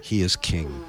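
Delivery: low, grave royal decree; solemn proclamation. Acted. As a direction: intone it like a herald. Solemn repeat of king proclamation.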